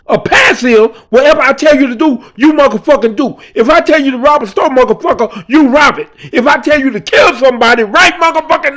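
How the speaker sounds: high, loud, furious tirade, fast at the start; angry gang enforcer. Retold or imitated. Enforcer schooling the boy on what a pass means: high, loud, furious rant. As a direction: rant at him, each line hammered harder.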